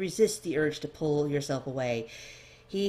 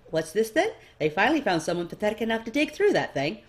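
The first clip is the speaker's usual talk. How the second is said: louder, fast, sneering jab; mocking noble; 5 semitones higher, 6 dB louder than usual. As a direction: tease her as a smug nobleman. Dorian mocks the scribe.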